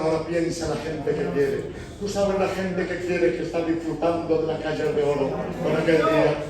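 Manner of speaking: normal talking voice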